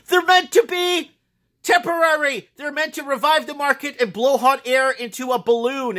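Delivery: worked-up, high squealing tirade; high-pitched rant. Real, not acted. Ranting to viewers about fake paper wealth, worked-up high voice.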